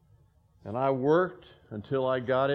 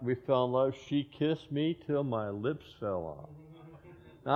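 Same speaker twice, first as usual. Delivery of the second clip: ordinary talk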